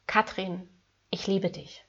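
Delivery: soft, quiet and loving, said to your own reflection; whispered-soft loving words into the mirror; tender self-affirmation. Shown as a demonstration. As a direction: gently tell yourself "Katrin, ich liebe dich" as if to someone dear. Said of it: "sagen Sie sich zum Beispiel".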